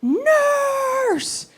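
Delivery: high mock cry for the nurse; shouting for the nurse. Mom calling the nurses in mock alarm. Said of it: "she just starts shouting".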